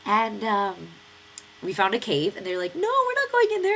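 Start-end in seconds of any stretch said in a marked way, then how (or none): 2.7-3.7 high, loud scared refusal; mock refusal. Friends balking at the cave, squealed mock refusal.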